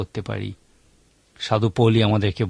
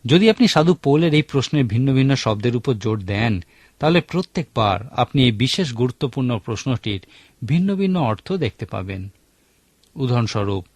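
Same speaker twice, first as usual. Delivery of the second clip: ordinary talk, nothing marked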